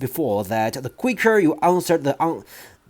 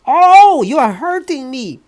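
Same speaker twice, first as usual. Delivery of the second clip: much higher, louder pained cry; 13 semitones higher, 9 dB louder than usual. Acted. Boy cries to headmistress as ears are pulled, high pained cry. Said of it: "Eric cried".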